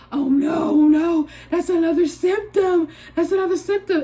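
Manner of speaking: new symptom, she frets in mock alarm; panicked. She frets at a stuffy nose, mock alarmed.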